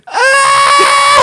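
very high, loud imitated shriek; little girl's scream. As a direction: mimic a small girl screaming. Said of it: "the little girl was like".

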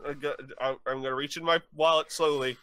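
scared pleading. Pretend. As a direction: beg not to be shot. Customer begs not to be shot.

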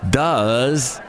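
DOES leaned on heavily and stretched; drawn-out last word. Shown as a demonstration. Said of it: "the last word of every sentence".

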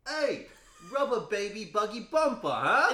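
a loud Cockney British accent. Shown as a demonstration. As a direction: bark it out like a cheeky Cockney. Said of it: "This is what I usually do".

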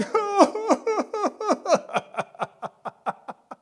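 high, loud mocking laugh falling away; preacher voicing mourners' laughter; mocking laughter imitated. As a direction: act out the crowd's scornful laugh.

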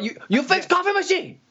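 high mock Chinese-accented English. Mandarin-speaking customer orders decaf, in a high mock Chinese accent. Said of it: "I was like".

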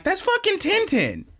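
high, exclaimed certainty; excited recognition. Retold or imitated. Recognizing the character's face, high excited exclaim. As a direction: shout it in delight. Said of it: "I was like".

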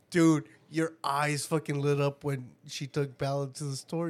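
normal talking voice

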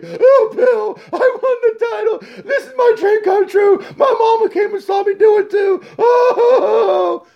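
high shouting blubber; mock sobbing champion. Mocking Fury tearfully telling Bill about his title, high blubber. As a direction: cry out the dream like a big baby.

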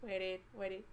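hushed, spaced-out mumble; quiet inner voice. Retold or imitated. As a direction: mutter it as an inner thought. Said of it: "And I'll be like".

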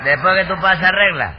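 ordinary delivery

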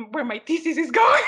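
giggling through a mock wail; laughing. Student jokes about a lost thesis, laughing and loud.

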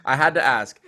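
loud, high-pitched retold protest; mock defensive backing off. Producer backing off after being refused, mock defensive. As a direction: back off noisily, playing it up.

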